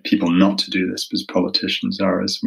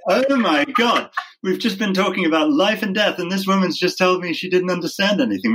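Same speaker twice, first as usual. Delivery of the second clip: aghast inner thought; 10 semitones higher than usual. Retold or imitated. Lawyer retelling his shocked thought about the juror, mock aghast. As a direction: voice the horror of realizing she understood nothing. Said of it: "And I'm sitting there thinking".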